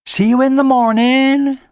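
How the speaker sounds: called back from the tunnel; calling out. Acted. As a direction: call back cheerfully as she leaves. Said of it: "Sunset called over her shoulder".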